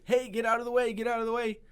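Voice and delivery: loud, high shout down the hill; yelling warning. Retold or imitated. Brother flying downhill yells at sister in the way, loud and high. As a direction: yell at her to move. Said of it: "So he's yelling at her".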